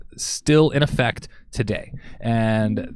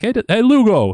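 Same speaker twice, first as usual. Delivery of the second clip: loud, high Chicago guy calling out; bad South Chicago accent, overdone; 12 semitones higher, 7 dB louder than usual. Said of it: "this is the worst South Chicago accent I've ever done".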